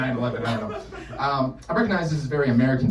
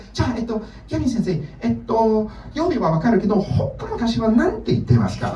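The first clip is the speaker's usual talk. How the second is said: student voice; 10 semitones higher, faster than usual. Retold or imitated. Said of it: "she goes".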